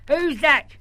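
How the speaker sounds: gruff uncle bark, loud and high. Acted. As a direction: bark it down the phone like a grumpy old man. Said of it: "a different voice barked".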